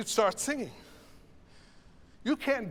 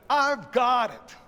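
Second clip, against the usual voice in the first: loud, slow, high declaration; emphatic response; 5 semitones higher, 8 dB louder, slower than usual. Shown as a demonstration. Choir director voicing the response to a preacher, loud and emphatic. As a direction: declare it back like a congregation. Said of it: "which is really what that".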